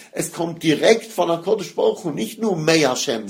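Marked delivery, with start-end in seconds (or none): none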